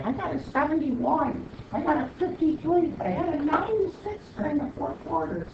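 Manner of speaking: high, whiny mimic of students bragging grades; mocking students. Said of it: "and they're going".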